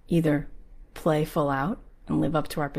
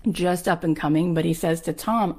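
usual speaking voice

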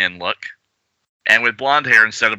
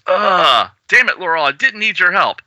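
annoyed grumble; 8 semitones higher than usual. Acted. Character annoyed at rescue.

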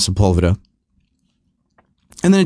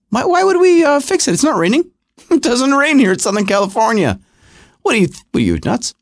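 much higher, incredulous mock official voice; 10 semitones higher than usual. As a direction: play the smug officials scoffing at the idea. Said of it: "what do they do".

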